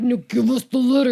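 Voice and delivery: loud, hammed-up angry order; mock angry demand. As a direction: demand it loudly, playing the furious guardian. Retold quarrel escalates over letter.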